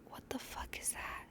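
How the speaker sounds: stunned whisper; hushed, shocked whisper. Retold or imitated. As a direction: breathe it out in disbelief. Reliving seeing the mouse.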